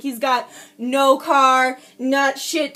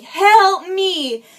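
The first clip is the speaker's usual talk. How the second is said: loud, higher imitated cry for help; retold scream for help; 5 semitones higher, 7 dB louder than usual. Retelling her plea to the neighbors' son, loud mock scream. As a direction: re-enact a desperate call, punched on help. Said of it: "I immediately just started screaming".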